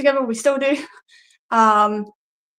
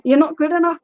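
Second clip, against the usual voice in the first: raised, lilting Newcastle-like twang; Newcastle-like accent; 5 semitones higher than usual. Retold or imitated. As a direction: deliver it with a stray Newcastle accent. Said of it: "just my accent kills me off in that".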